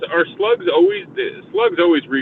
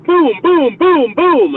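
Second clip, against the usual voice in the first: loud, high booming shots; hammed-up volley of mock blasts; imitated gunshots; 13 semitones higher, 8 dB louder, much slower than usual. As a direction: fire off the booms like rapid shots. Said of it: "I'm like".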